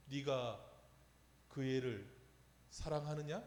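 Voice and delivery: a hint of the old pastor's soft, low, slow question; gentle question. Senior pastor gently questioning the young preacher, soft and low. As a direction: ask it quietly, letting it sink in. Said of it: "지그시 보시더니".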